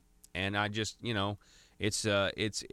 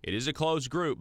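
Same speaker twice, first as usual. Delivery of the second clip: upbeat radio host plug; 7 semitones higher than usual. Acted. Host hypes guest before break.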